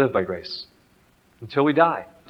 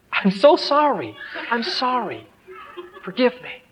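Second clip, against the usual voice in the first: high-pitched, mildly pleading; pleading apology; 7 semitones higher than usual. Acted. Father leaning over to his son, acting out an apology. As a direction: beg forgiveness in a lifted, acted voice. Father begs son's forgiveness.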